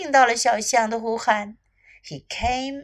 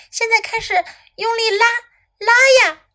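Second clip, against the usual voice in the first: high-pitched urging shout; calling out; 9 semitones higher, 4 dB louder, much faster than usual. A narrator's reading. Snake urging little elephant to pull, calling out. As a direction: build the command up to a shout. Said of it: "蛇对小象喊道".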